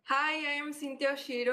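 normal talking voice

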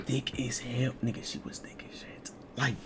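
soft secret whisper. Real, not acted. Confiding aside to viewers.

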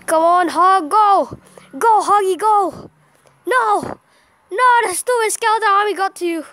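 loud, high cheer turning to dismay; urgent shouting. Real, not acted. Player calling to his Hog Rider, urgent shouting. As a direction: urge it on, then cry out thwarted. Urging on troop, then thwarted.

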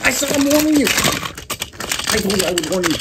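high, slow, pleading warning; scared warning. Pretend. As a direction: hold it off, terrified. Warning off a zombie.

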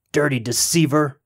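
a touch of an angry devil's shout; trapped devil lightly shouting at his tricker; mild shout. A narrator's reading. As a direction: spit the accusation, understated. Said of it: "shouted Old Nick".

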